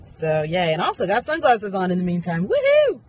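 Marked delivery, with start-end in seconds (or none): 2.5-3.0 high, gleeful whoop; a happy little celebratory woohoo; excited whoop. Real, not acted. Cheering over new sunglasses.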